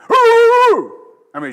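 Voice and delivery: loud, high barking imitation; a sudden loud woof imitation; imitated dog bark. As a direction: bark like the big angry dog. Imitating dog's aggressive bark.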